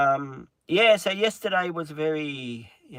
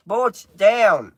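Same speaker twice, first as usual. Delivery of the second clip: sharp, loud, higher "down"; command to the dog; 8 semitones higher, 11 dB louder than usual. Real, not acted. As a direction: order the dog off firmly. Telling the dog to get down.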